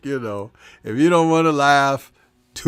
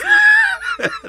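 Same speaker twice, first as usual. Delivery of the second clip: very high squealing burst of laughter; shrieking laugh. Real, not acted. Reacting to Shower's "Defeated" comment: high shrieking laugh.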